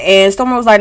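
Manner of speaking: normal talking voice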